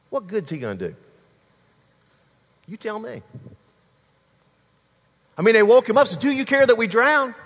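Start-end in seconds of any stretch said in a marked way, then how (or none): 6.2-7.3 high, loud demanding question; raised voice, pitched high; urgent outcry. Acted. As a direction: cry out over the storm. Disciples waking Jesus in storm.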